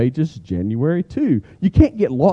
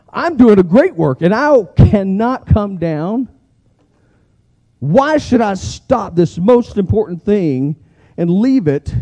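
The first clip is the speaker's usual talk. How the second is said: defiant declaration; 3 semitones higher than usual. Acted. Defiant answer to distractions.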